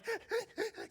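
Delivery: high, gasping mock sobs; stuttering, breath-catching cry imitation; imitated sobbing. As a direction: imitate a child catching breath while crying. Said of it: "trying to catch your breath kind of cry".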